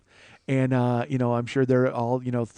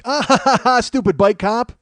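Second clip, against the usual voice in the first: mock laugh at the bike cop, raised and louder; 6 semitones higher, 5 dB louder than usual. People mocking the bike cop, a jeering laugh. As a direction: sneer and cackle at him.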